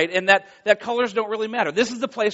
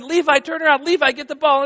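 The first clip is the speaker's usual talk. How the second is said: coach yelling; 8 semitones higher, 4 dB louder than usual. Retold or imitated. Coach yelling at frozen kid.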